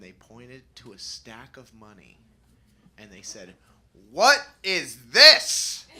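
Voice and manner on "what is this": loud, slow, shocked question; shocked demand. Retold or imitated. Parents confronting their son over a stack of money, shocked and loud.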